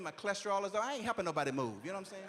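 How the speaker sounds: ordinary delivery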